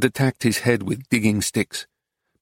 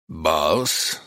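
put-on gravelly American accent; 7 semitones lower, 3 dB quieter than usual. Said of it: "in a gravelly American accent".

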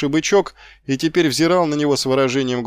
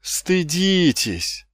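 high, drawn-out scolding; long, indignant reproach; reproachful exclamation; 6 semitones higher, much slower than usual. A narrator's reading. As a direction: shame them, stretching the word out. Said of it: "укоризненно воскликнул Маркоу".

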